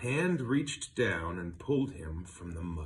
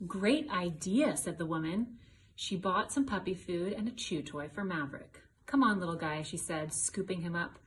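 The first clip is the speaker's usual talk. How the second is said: higher, bright read-aloud voice; storybook reading; 10 semitones higher than usual. A narrator's reading. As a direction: read it out to the little ones. Child reads the story aloud.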